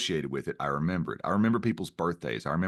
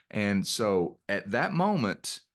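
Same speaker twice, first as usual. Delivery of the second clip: normal talking voice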